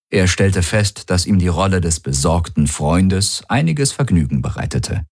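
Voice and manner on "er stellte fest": ordinary delivery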